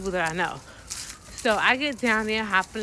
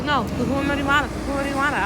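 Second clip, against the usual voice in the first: louder, faster insistent Spanish; a touch of a pushy Colombian taxi driver; 5 semitones higher, 4 dB louder, faster than usual. Retold or imitated. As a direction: push the question like a pushy driver. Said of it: "But he's like".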